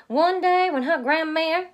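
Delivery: bright Cajun narration, projected; Cajun accent. A narrator's reading. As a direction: Cajun teller building up. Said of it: "my take on their accent".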